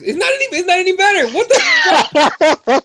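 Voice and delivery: high-pitched, laughing in disbelief; real laughter breaking through the words. Real, not acted. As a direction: crack up at how absurd it is. Reading a silly fighter nickname.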